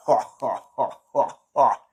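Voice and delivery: mock evil Santa laugh; villain laugh. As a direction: cackle at the puny challenger. Santa mocks the hero.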